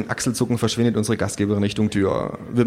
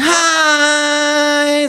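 very high, loud, long "Hi" like a dentist's drill; drawn-out shrill greeting; 6 dB louder than usual. A narrator's reading. Trendy hostess greeting guests, piercingly drawn-out. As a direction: stretch the vowel into a piercing whine. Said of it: "das langgezogene I hat verblüffende Ähnlichkeit".

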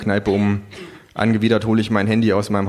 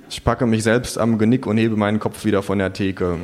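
usual speaking voice

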